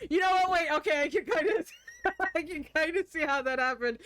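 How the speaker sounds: high, loud talk through laughter; real laughing realization. Real, not acted. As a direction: concede it while cracking up. Cracks up after own accent attempt.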